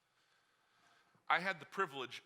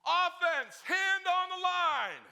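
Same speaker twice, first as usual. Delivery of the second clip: drawn-out bark, much higher and louder; coach yelling; 9 dB louder, much slower than usual. Retold or imitated. Coach calling the offense to the line, yelling. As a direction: call the team to the line like a coach. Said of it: "he yells".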